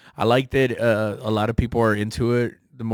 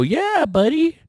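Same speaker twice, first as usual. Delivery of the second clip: cheerful buddy; 4 dB louder, slower than usual. Retold or imitated. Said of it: "And they go".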